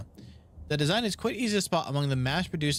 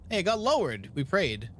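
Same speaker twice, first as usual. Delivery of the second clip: ordinary talk, nothing marked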